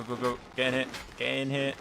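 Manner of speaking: normal talking voice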